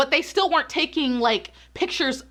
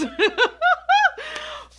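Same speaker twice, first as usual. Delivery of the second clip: sudden high cackling laugh; high burst of laughter. Real, not acted. Vlogger mocking the youthful ignorance excuse, bursting into high laughter.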